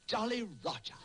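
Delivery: high, overjoyed cry of praise; overcome with emotion. Acted. Tutor praising Jack's answer, a choked-up burst of pride. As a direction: tutor thrilled by his pupil's answer, voice jumps high with emotion. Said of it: "I'm becoming emotional".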